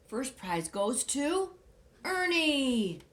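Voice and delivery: slow build to a loud, high name; drum-roll pause then proclaim; announcer building to the winner's name; announcing winner. Acted.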